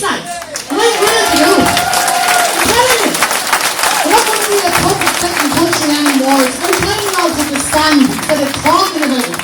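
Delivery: fired-up speech yelled at a protest meeting; impassioned shout. Real, not acted. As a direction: roar it out to rouse the room. Rallying crowd at public meeting.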